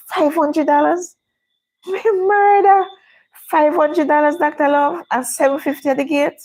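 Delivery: can't believe that price; high incredulous outcry at the ticket price; outraged disbelief. Real, not acted.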